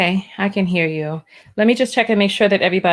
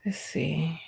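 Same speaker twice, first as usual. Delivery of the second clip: whisper; 5 semitones lower, 13 dB quieter than usual. Real, not acted. Host muttering to himself.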